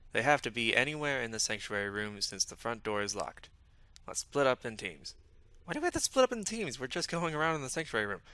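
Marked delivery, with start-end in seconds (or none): 5.6-8.2 high, quick complaint; protesting. Acted. Teammate objecting to splitting up in the sanctuary, protesting. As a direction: object in a rapid, high-pitched voice.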